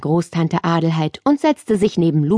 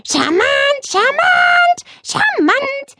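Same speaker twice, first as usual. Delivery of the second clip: very high squawking parrot voice; a proud parrot repeating the word; croaking parrot; slower than usual. A narrator's reading. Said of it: "krächzte Plemplem".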